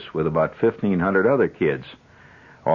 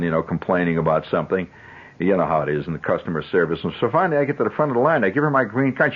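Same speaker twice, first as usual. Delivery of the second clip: normal talking voice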